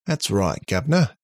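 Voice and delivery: put-on Australian accent, lifting high on the last word; playful Aussie-accented robot. A narrator's reading. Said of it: "again in the Australian accent".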